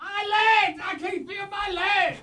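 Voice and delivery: high hammed-up cries of pain; shouting while wounded. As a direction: scream that your legs are gone, over the top. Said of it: "you just moan, screaming".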